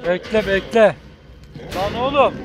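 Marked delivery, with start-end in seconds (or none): none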